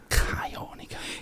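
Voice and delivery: whisper. Pretend. Joking aside about avoiding sport.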